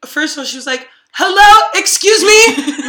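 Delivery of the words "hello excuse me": much louder, high-pitched outraged diner; high, loud imitation of an irate customer; mock shouting. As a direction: holler it in mock outrage, stretched out. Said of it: "she's shouting so loud".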